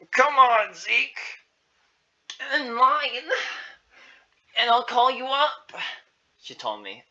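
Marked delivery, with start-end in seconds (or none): 0.1-5.7 high, raised, encouraging voice; coaxing caretaker. Acted. Beth sending Zeke to the line, coaxing. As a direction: call the child along in a high voice.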